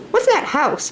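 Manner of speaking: put-on Laura's high, eager child voice. Laura asking Pa about the house, high childlike voice. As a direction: pitch it up like a curious little girl. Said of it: "Laura asked".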